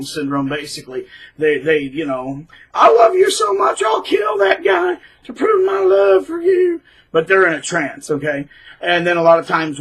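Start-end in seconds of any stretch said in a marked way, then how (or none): 2.7-6.8 high, loud, hammed-up lovestruck shout; over-the-top besotted follower; mock devoted flying monkey. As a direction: shriek a mock vow of love.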